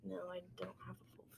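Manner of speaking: whispering. Real, not acted. Playful back and forth, hushed.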